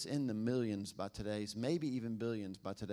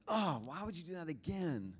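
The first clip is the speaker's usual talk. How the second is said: exasperated parent; 5 semitones higher than usual. Retold or imitated. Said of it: "You have every reason to be like".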